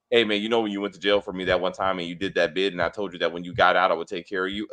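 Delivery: low-pitched buddy reciting shared history; mock expository dialogue. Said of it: "why are we talking like this".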